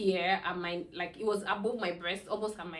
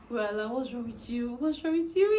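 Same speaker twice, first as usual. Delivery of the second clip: worried husband; 4 semitones higher than usual. Retold or imitated. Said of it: "and he was like".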